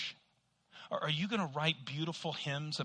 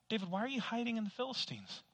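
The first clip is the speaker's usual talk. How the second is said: questioning voice; 6 semitones higher, 5 dB quieter, faster than usual. Acted. Voicing a questioner to David.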